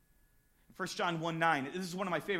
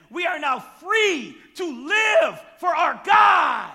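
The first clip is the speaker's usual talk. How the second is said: slow, loud and higher, proclaiming; preached shout; 8 semitones higher, 13 dB louder, slower than usual. Acted. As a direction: shout the freedom out. Preaching climax.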